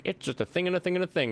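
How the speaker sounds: fast, high mock explanation; Mumbo Jumbo. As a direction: rattle it off like a YouTuber skipping the details. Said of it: "he's just like".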